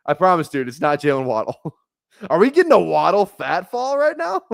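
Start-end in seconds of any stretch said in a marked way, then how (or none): 2.0-4.5 laughing excited. Real, not acted. Laughing at the fat Waddle photo.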